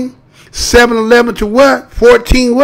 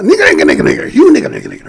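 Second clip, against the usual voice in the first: imitating the teacher; 2 semitones higher, much faster than usual. Mimicking the teacher addressing her class, fast and pitched up. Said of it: "She said it real quick".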